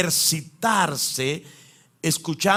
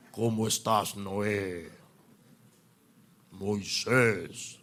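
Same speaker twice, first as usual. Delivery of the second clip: deep, low, guttural movie-God voice; 8 semitones lower, 5 dB quieter, slower than usual. Retold or imitated. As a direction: boom it out like the voice of God in the films. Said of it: "como una voz gutural. Gruesa".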